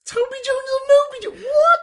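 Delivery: very high, loud incredulous squeal; squeaky, laughing astonishment; astonished. Real, not acted. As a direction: shriek it back in amused disbelief. Astonished at silly game name.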